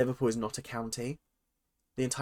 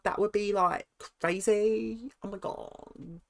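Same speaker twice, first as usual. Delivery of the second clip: mock reality contestant; 8 semitones higher than usual. Vlogger quoting an iconic Love Island moment, mock contestant voice.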